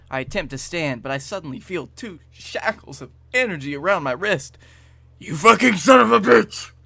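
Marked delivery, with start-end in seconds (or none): 5.2-6.7 high, loud cursing yell; angry outburst. Acted. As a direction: hurl the insult at full voice. Acted outburst at the screen.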